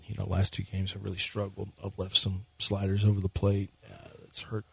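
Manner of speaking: low, soft, defeated mumble; mumbling dejected pitcher. Acted. Co-host playing the pitcher in a gloomy mumble. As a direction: answer like a beaten-down player. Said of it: "I'll be Ryan Presley".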